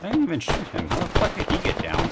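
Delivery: high, baffled disbelief; surprised. Real, not acted. Enemy suddenly drops.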